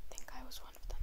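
hushed whisper. Real, not acted. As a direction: whisper so the guards won't hear. Sneaking past guards.

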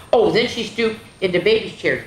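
plain reading voice

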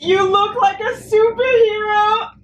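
very high, loud, slow wail; emotional squeal. Real, not acted. Reader quoting the superhero line, overcome squeal.

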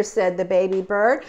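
ordinary delivery, nothing marked

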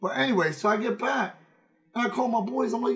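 usual speaking voice

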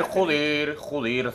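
muttered curses fading away; cursing under breath. Real, not acted.

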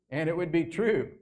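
ordinary talk, nothing marked

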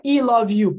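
"I" said as "ee" in "I love you"; wrong letter sound. Shown as a demonstration. Teacher asking students why it isn't this, mispronounced. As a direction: read "I" the Portuguese way, "ee love you". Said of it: "Por que não é".

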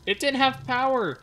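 high, loud exasperated cry; real annoyed yell; frustrated outburst. Real, not acted. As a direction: blurt the discovery out in dismay. Realises missing power.

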